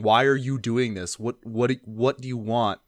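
usual speaking voice

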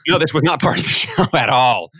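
high-pitched reply breaking into a laugh; laughing denial. Real, not acted. Storyteller answers host's question about the show, laughing denial. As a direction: laugh through an emphatic no.

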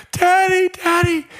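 very high, loud mock child's cry. Lost girl calling to her running father, high wail. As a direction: wail like a little girl seeing her dad.

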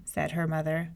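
plain reading voice

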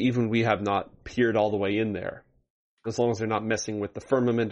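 normal talking voice

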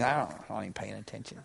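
low, quick, mumbled husband voice; distracted husband. Pastor voicing the husband cleaning his glasses, mumbled and distracted. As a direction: toss it off half-listening, lightly voiced. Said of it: "he just keeps on cleaning".

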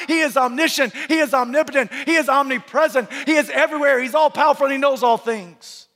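high, driving pulpit cadence; forceful preaching. Real, not acted. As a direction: preach it in relentless, building declarations.

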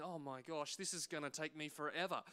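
low, soft grumble to himself; grumbling. Retold or imitated. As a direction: grumble under your breath at a tedious chore. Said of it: "I'm, I'm grumbling".

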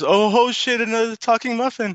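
very high startled muffin voice; punchline cried in high-pitched disbelief; mock shock. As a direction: squeal it like an astonished cartoon muffin. Voices startled second muffin in joke.

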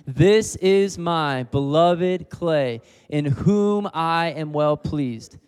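loud, slow and high, each word stretched out; loud slow declaration. Shown as a demonstration. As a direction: proclaim it aloud to the whole room. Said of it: "this is what the Father is saying over you".

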